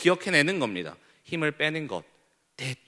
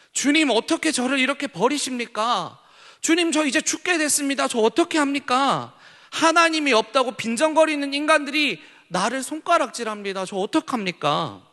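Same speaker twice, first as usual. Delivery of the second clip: a higher, pleading cry to God; David's desperate plea; 8 semitones higher than usual. Acted. David fleeing his son cries out to God, higher and pleading. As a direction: beg like a man about to die.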